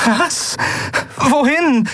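very high, bewildered questioning; startled questions. Acted. Endriel as Keru takes over flying, startled questions. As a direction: blurt it high and thrown.

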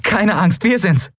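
high, happy reassurance; delighted, high-pitched greeting; jubilant. A narrator's reading. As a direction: reassure him joyfully in a high voice. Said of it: "jubelte Endriel".

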